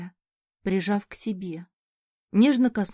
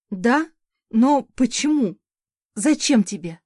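higher and louder, sob-choked questions; 3 semitones higher, 6 dB louder than usual. A narrator's reading. Exhausted woman sobbing into her husband's chest. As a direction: break into a sob as you ask. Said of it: "Громко всхлипнула".

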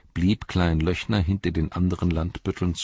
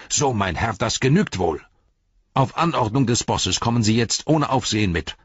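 higher and louder, stiff and self-important; officious captain; 6 semitones higher, 6 dB louder than usual. Acted. Captain ordering Frodo to come along, officious. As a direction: lecture the prisoner like a petty official.

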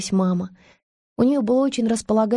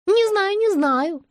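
much higher, coy and singing; coy sing-song; 12 semitones higher, slower than usual. Acted. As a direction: flirt it out, drawn and playful. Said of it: "певучий жеманный ответ".

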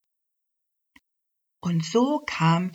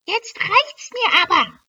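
much higher, louder fed-up outburst; fed-up elf; 7 dB louder than usual. Acted. Elf fed up with the badger, high indignant outburst. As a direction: blow your top like a tiny fairy. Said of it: "sagte die Elfe und schmiss ihre Sachen hin".

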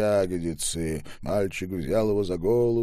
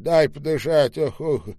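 put-on louder, higher gasping old man; mildly breathless old man; 6 semitones higher, 7 dB louder than usual. A narrator's reading. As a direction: pant as if short of breath.

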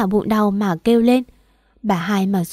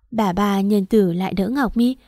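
plain reading voice

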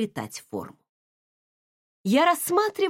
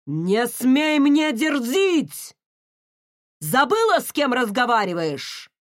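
booming voice; 3 semitones higher, 5 dB louder than usual. Acted. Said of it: "Гулкий голос отца эхом отразился".

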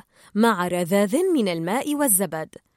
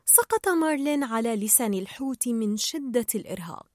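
ordinary delivery

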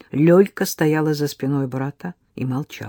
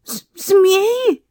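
high, stammering frightened cry; terrified; 6 dB louder, much slower than usual. Acted. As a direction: gasp it out in terror. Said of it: "с ужасом спросила она".

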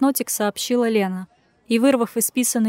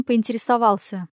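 ordinary delivery, nothing marked

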